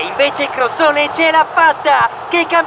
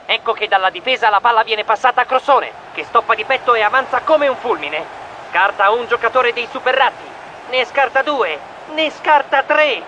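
lively radio play-by-play; match commentary. Acted. Commentator Dribbling calling the match live, lively play-by-play. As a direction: call the match like a sports commentator.

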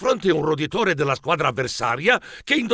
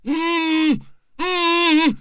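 high-pitched muffled moans; mouth-full mumbling; 12 semitones higher than usual. A narrator's reading. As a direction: moan through a mouthful of sweets. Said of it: "mugolò mio cugino con la bocca". (mugolio acuto a bocca piena)

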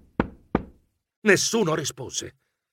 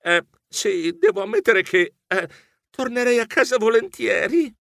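hesitant, higher-pitched confession; reluctant admission; 7 semitones higher than usual. A narrator's reading. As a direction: admit it sheepishly, with little halts. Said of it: "Io ammisi".

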